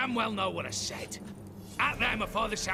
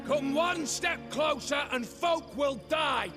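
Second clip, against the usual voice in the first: high, raised-voice warning; shouted threat; 6 semitones higher than usual. Acted. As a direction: call out a threat to attackers. Hostage taker warns guards off.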